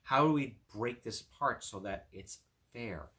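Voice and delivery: ordinary delivery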